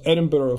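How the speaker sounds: "Edinburgh" ending in "burra"; Scots-style "Edinburgh"; Scottish pronunciation. Shown as a demonstration. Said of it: "from the Scottish people".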